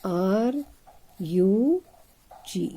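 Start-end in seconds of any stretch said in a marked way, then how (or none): none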